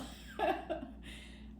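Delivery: high airy giggle; light amused laugh; breathy laugh. Real, not acted. Laughs at big tarot spread.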